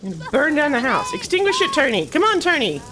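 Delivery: high, loud pleading at the screen; frantic urging. Real, not acted. Gamer begging Tony to extinguish fire, frantic urging. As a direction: yell urgently as things go wrong.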